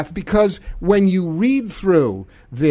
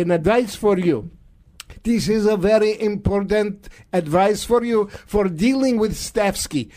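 mock Russian accent, reassuring strongman; hammed-up Russian accent, comically sinister advice; Vladimir Putin. Russian leader advising Donald on problem staff, mock Russian accent. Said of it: "I'm now talking in a Russian accent".

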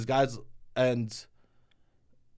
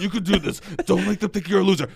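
mock self pep talk; 5 semitones higher, 5 dB louder than usual. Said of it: "but you seem like, you go".

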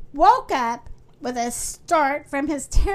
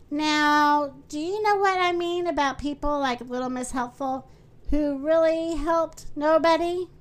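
ordinary delivery

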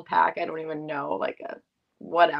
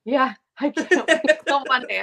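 higher, louder, giggling through it; laughing mock; 8 semitones higher, 5 dB louder than usual. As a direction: crack up while saying it. Mocking their own jinx phrase.

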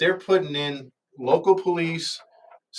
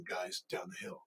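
low, soft raspy voice; 6 semitones lower, 18 dB quieter than usual. Retold or imitated. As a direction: rasp it out low and quiet. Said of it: "You hear a raspy voice going".

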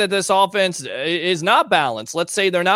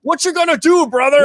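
high, loud Hulk Hogan-style growl; wrestling promo; 8 semitones higher, 6 dB louder than usual. Retold or imitated. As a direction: yell it like a pro wrestler on the mic. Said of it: "it sounds like you're doing a wrestling promo".

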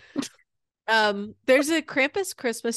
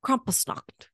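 Krampusnacht whispered; 3 semitones higher than usual. Shown as a demonstration. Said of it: "whispered take".

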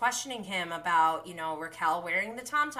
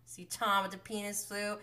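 ordinary delivery

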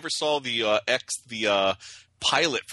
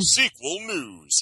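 1 announcer voice; 5 semitones higher, much slower than usual. Pretend. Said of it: "announcing a new segment".

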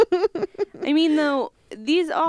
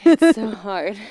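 laughing; 3 semitones higher, 9 dB louder, slower than usual. Real, not acted. Laughing at breathing into mic.

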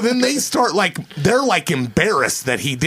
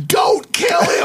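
high, yelled plea to stop; panicked shout; slower than usual. Retold or imitated. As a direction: shout it like begging them to stop. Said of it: "Wayne going".

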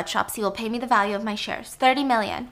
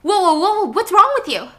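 alarmed shout; 9 semitones higher, 7 dB louder than usual. Acted. Spear bow aimed at her.

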